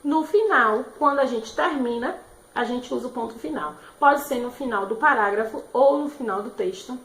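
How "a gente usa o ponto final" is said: normal talking voice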